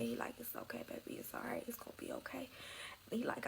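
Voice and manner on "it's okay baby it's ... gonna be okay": hushed, near-whispered reassurance; retold soothing. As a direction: soothe a crying partner very softly. Boyfriend comforting her, retold.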